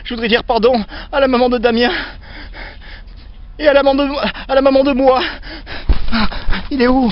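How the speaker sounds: high, loud panicked pleading; scared horror game streamer. Acted. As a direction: beg and scream in terror. Ghost clown is there, pleading.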